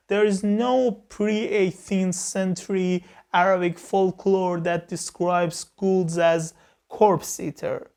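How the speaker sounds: ordinary talk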